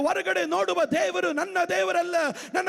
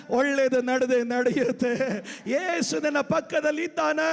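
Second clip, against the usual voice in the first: usual speaking voice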